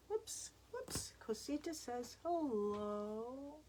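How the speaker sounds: very soft, slow cooing to a pet; playful pet talk. Real, not acted. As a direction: coo gently, drawing out the greeting. Plays with visiting pet, greeting for it.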